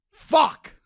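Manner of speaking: sudden high outburst of frustration; exasperated curse; angry. Real, not acted. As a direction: blurt it out high, fed up. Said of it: "I'm fucking angry".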